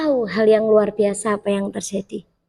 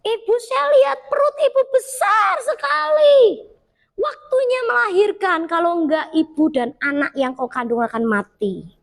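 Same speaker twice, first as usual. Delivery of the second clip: urgent prophetic warning; 9 semitones higher than usual. Retold or imitated. Woman prophesying urgently in prayer.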